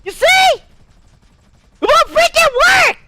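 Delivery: very high, strained character voice; high, forceful put-on voice giving out; failing put-on voice. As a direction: push the high voice hard in frustration. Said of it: "won't freaking allow me to keep the voice going".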